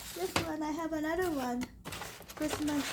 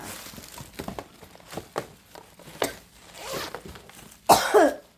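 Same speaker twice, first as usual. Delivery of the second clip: harsh dry cough; 11 semitones higher, 8 dB louder than usual. Real, not acted. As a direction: cough hard, unwell. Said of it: "I'm not feeling well".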